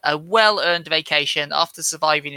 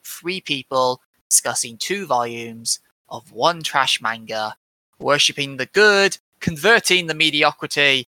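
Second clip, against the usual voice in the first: normal talking voice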